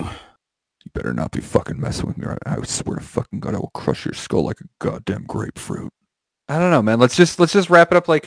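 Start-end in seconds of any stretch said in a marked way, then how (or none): 0.0-5.9 low rasping whispered threat; barbarian. Acted. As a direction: growl it like a savage warrior. Menacing threat to co-host.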